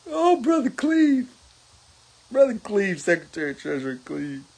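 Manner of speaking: ordinary talk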